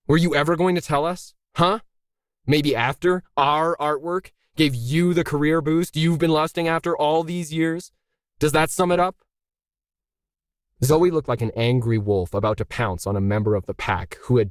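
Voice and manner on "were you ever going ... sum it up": rising accusation. A narrator's reading. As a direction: pitch climbing higher with each jab. Noah confronts Zoe angrily.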